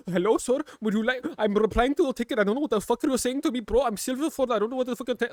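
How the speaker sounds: high, loud, hammed-up support worker; mock support agent. Underpaid support agent replying to a ticket, mockingly hammed up. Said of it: "underpaid Indian fucking su-, support ticket team".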